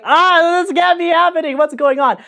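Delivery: high, loud ecstatic freak-out; screaming in disbelief. Retold or imitated. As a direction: re-enact a shrieking freak-out over good news. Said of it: "I remember just screaming".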